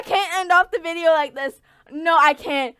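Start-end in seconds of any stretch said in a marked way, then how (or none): none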